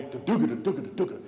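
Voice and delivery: loud, bouncing thudding syllables; imitated heavy footsteps. Storyteller mimicking large mother thundering down hallway, imitated noise. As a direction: pound out running steps by voice. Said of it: "da-dooga-da" noise".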